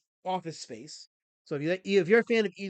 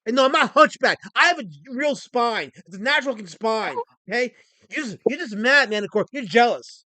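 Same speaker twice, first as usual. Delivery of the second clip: mock angry rant; 6 semitones higher, 9 dB louder than usual.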